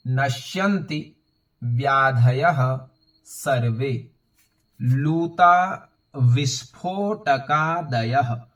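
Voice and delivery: drawn-out, measured word-by-word Sanskrit reading; slow teaching recitation. Shown as a demonstration. Teacher shows learners how to read the forty-seventh shlok, slow recitation. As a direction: recite slowly, syllable by syllable. Teacher breaks next verse down.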